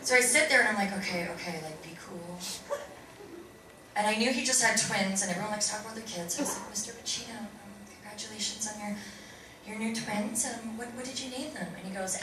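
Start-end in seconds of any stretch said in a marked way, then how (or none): 1.0-2.4 quietly steady yourself under your breath; nervous self-talk. Retold or imitated. Retold inner pep talk in the car, nervously hushed. Telling himself to stay calm. 6.7-11.7 soft, hesitant, deferential approach; timid small talk. Retold or imitated. Nervous actor congratulating a famous co-star on twins, timid and soft.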